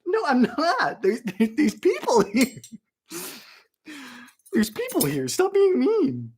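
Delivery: high, whiny mock indignation; mock protest. Streamer defending viewers to YouTube, whiny mock protest. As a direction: plead in a put-on high voice. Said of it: "I said".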